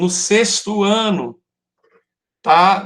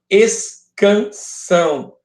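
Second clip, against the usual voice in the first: "escansão" in three long beats, es... can... são; separado em sílabas; 4 semitones higher, much slower than usual. Shown as a demonstration. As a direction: spell out "escansão" slowly, syllable by syllable. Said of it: "Escansão é a separação". ("escansão" pausado, sílaba por sílaba)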